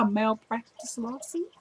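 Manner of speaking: very soft, slow, rising question; pointed threat. Retold or imitated. Patient threatening the doctor with a lawsuit, slow and pointed. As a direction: spell it out slowly and quietly as a warning. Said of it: "Can you see her saying it like that?".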